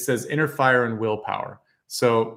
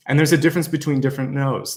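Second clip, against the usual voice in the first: ordinary talk, nothing marked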